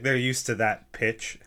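ordinary talk

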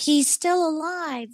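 put-on soft, slow child's whisper-like voice; high-pitched kid voice. Imitating the little girl ghost in the clip, high-pitched. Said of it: "high, high, high pitched".

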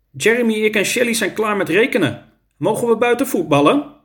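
high, lightly voiced schoolboy; a hint of a child seeking permission; eager pupil asking. A narrator's reading. Carlos asks to play football outside, light high child voice.

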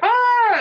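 high, loud sustained "ah" scream; retold frustrated scream. Adoptee recalling screaming at her mirror, frustrated scream. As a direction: cry out in re-enacted angry frustration. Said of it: "screamed so loud".

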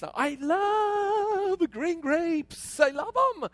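high, drawn-out LOVE; gushing enthusiasm. Real, not acted. Father tells congregation about his favorite grapes, gushing. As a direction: relish it, pitched high and slow at first.

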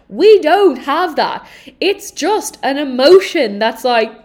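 ordinary talk, nothing marked

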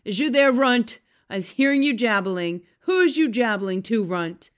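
loud bullying giant calling out; thunderous shout. A narrator's reading. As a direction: thunder it out at the runt. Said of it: "a voice like thunder shouted".

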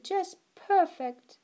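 grandma cooing over the cake. Acted. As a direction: coo it with delight. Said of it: "Grandma cooed".

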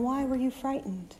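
soft and gentle. Acted. Jesus asking the disciples, soft and gentle. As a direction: ask tenderly, not scolding. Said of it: "sticking with soft and gentle".

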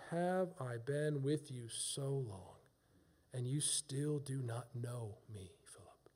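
slow, low, softly voiced quote; hushed reproach. Jesus asking Philip why he still does not know, hushed reproach. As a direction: let the question sink almost to a whisper.

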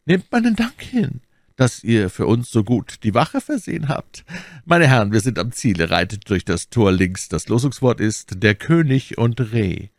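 put-on high, strained old cardinal. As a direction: raise the voice into the cardinal's pitch. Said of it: "sagte Richelieu".

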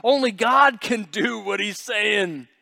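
scandalized whisper-to-neighbour astonishment; mock shocked bystanders; shocked onlookers. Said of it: "everybody looking in like".

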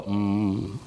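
low, soft mock-meditation "mm"; meditation hum. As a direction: hum quietly, imitating someone meditating. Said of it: "You do not sit and go".

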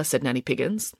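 ordinary delivery, nothing marked

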